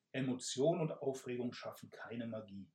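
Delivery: ordinary delivery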